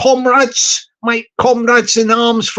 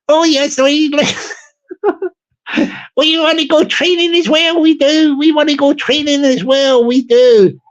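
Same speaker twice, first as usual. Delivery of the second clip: higher, lilting Belfast lady voice; mock Northern Irish lady; 4 semitones higher than usual. Streamer voicing a lady from Belfast, high and lilting. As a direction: chirp it like a little Belfast lady.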